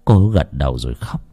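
usual speaking voice

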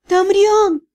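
высоко, с отчаянным стоном; отчаянно выкрикнуть жалобным высоким голосом; простонать. A narrator's reading. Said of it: "простонала я". (жалобный стон, высоким голосом)

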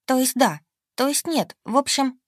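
high, flustered babbling. A narrator's reading. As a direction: trip over the words nervously. Flustered heroine stammering.

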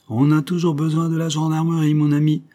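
lightly voiced pompous gendarme. Gendarme asserts authority. (gendarme pompeux)

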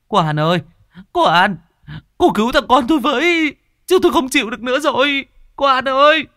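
high, loud, frantic cries for help; panicked shrieking. A narrator's reading. Frantic mother shrieking to Cô An to save her son. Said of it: "hốt hoảng ý éo gọi".

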